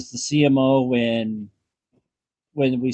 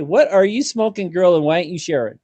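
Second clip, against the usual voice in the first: high, loud mock disbelief; teasing Pelly as if she's stoned; incredulous Scotty; 5 semitones higher, 5 dB louder than usual. Podcaster imitating Scotty to baffled Pelly, hammed-up disbelief.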